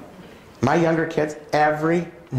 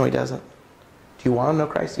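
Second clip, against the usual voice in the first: usual speaking voice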